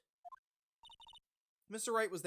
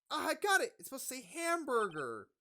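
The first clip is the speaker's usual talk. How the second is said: much higher and louder exclaim; eureka; 11 semitones higher, 7 dB louder than usual. Acted. Maya guessing the sign says hamburger, loud high exclaim. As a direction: burst out with the idea.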